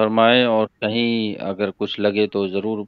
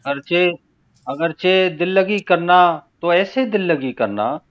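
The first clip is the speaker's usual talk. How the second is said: measured, unsung ghazal recital; spoken verse recitation; 5 semitones higher than usual. Acted. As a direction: recite phrase by phrase with deliberate pauses. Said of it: "मैं तहत में ही पढ़ता हूँ".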